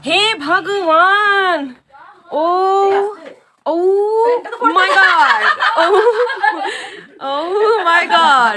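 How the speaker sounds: loud, high, drawn-out exclamation; long wailed oh-my-god; amused outcry. Real, not acted. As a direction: cry out in laughing disbelief. Startled, laughing outcry to companions.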